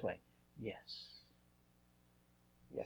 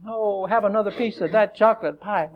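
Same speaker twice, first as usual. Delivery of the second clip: tempter voice; 7 semitones higher than usual. Acted. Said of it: "Tempt, lure, entice".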